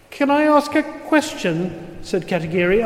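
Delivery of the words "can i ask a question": higher, hesitant voice; timid man. A narrator's reading. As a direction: ask timidly. Katagiri character voice.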